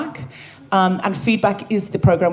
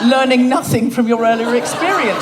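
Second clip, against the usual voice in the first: loud, higher mock telling-off; mock scolding; 5 semitones higher, 4 dB louder than usual. Real, not acted. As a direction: scold your co-host in exasperation. Scolds co-host for repeating it.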